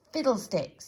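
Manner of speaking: pompous scoff; dismissive scoff. Acted. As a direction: brush him off. Dismissive character.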